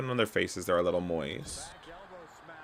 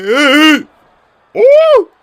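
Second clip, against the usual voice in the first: very loud, high-pitched squeal; piercing high whoop; 22 dB louder than usual. Real, not acted. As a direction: squeal out as the move comes. Going for a move in game.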